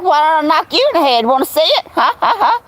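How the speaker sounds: fast taunting comeback with a fake laugh; mock threatening. Her comeback to the old men, fast mock-menacing taunt. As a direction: taunt them back, ending in a cackle. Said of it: "And I'm like".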